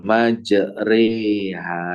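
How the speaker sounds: very slow Majreha with ro tilted to re; imalah on Majreha. Shown as a demonstration. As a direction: lean the ro into e in Majreha. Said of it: "imalah gitu".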